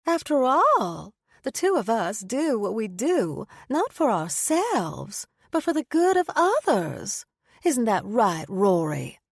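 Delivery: slow, high, sugary Southern belle; Pandora's fake-friendly Southern drawl; southern accent. A narrator's reading. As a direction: lay the Southern accent on thick and sweet. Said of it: "emphasizing her southern accent".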